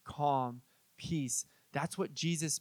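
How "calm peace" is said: low, soft hush into peace; gentle fading hush; hushed calm. Shown as a demonstration. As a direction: let the word fade like mist. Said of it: "that misty".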